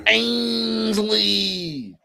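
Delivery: high, sustained drawn-out call; mock name call. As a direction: echo the name, stretching each syllable. Echoes the mocking name call.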